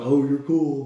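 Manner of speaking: loud, high mocking jab; mock hater. Car owner imitating his haters, mocking.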